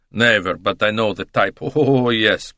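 put-on emphatic Italian-accented denial; expressive Italian with a drawn-out oh; knowing Italian.

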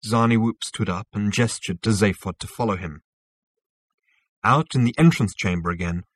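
ordinary delivery, nothing marked

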